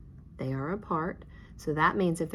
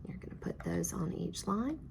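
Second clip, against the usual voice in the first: whisper; 6 dB quieter than usual. Real, not acted. Teacher whispers during student work.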